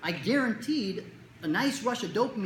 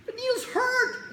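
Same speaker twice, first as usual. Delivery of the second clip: put-on much higher, slow whimpering kid; whiny kid; 5 dB louder, much slower than usual. Kid protesting to the doctor, high slow whine. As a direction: whine it like a scared little boy.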